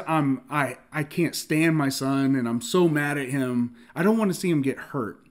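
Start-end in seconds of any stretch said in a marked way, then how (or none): none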